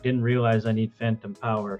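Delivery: ordinary talk, nothing marked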